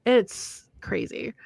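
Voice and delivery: slow, unvoiced whisper. Real, not acted. Reacting to how long they've known each other, whispered. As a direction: breathe it in awe.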